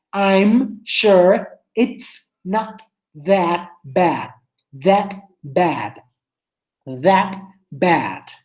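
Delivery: "I'm sure it's not" stretched out word by word; slow drill. Shown as a demonstration. Teacher drilling the phrase very slowly for students.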